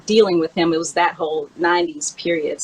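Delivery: usual speaking voice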